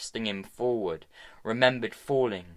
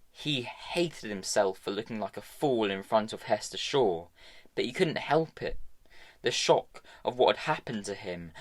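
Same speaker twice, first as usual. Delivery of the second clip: ordinary delivery, nothing marked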